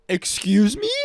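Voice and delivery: real outrage at a claim; incredulous. Real, not acted. Reacting to Pam Bondi's claim, loud incredulous excuse me.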